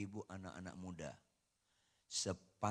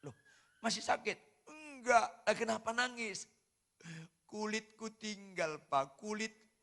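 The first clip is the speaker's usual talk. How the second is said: voiced child; 10 semitones higher, 4 dB louder than usual. Child and father dialogue retold.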